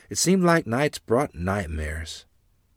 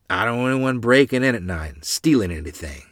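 loud, somewhat raised angry retort; angry reply; 4 semitones higher, 9 dB louder than usual. A narrator's reading. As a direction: snap back as an angry father. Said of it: "was Father's angry reply".

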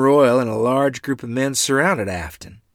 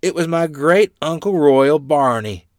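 plain reading voice

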